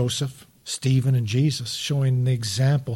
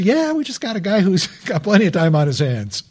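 dry joke with a laugh in it; deadpan offer, laughing through it; mock-helpful answer, laughing midway; laughing mock reply; 5 semitones higher than usual. Ironic church reply.

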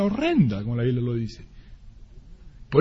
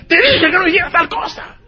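sharp, much higher scolding voice; scolding parent; 12 semitones higher, 4 dB louder than usual. Retold or imitated. Parent scolding the child who ran into the road, sharply.

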